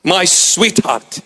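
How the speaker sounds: ordinary talk, nothing marked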